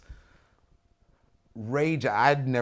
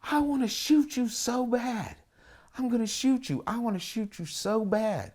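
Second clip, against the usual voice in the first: much higher, slow, unhinged threat; menacing threat; 10 semitones higher than usual. Retold or imitated. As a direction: creep out the listener. Guy threatens to shoot.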